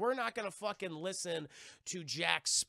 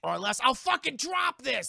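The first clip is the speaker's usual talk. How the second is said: higher, much louder defiant yell; shouted threat; 7 semitones higher, 8 dB louder than usual. Retold or imitated. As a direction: threaten them at full voice. She threatens the medallion.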